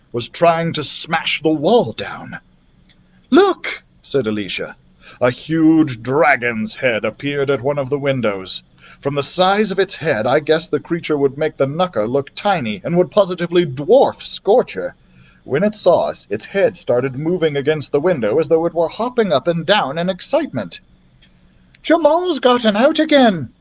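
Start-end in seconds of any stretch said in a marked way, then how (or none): 3.3-3.8 high, loud "Look!"; alarmed cry. Acted. Alicia seeing a dragon at the window, loud high cry. 21.8-23.5 high, louder alarmed call; excited cry. Acted. Darcy seeing the escaped dragon, high excited cry. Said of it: "cried Darcy".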